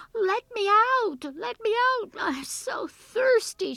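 high, drawn-out shouted plea; slow, loud wail from a trapped girl; desperate cry. A narrator's reading. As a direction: cry out to be freed. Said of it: "she cried".

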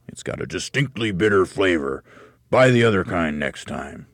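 put-on grumbling old man voice; grumpy old man. Husband's voice in story.

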